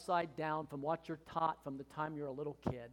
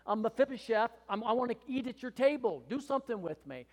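raised, earnest surrender prayer; humble prayer; 6 semitones higher, 5 dB louder than usual. Retold or imitated. Pastor voicing a believer's prayer, raised and earnest. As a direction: offer yourself to God. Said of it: "It's just saying".

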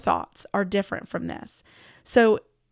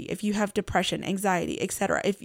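ordinary talk, nothing marked

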